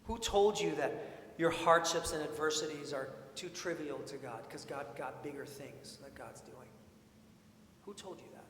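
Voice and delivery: ordinary talk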